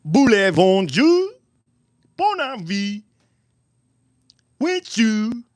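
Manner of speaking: high, drawn-out fake French; sing-song pretend French, held high; mock French accent. Streamer playing at speaking French, high mock French accent.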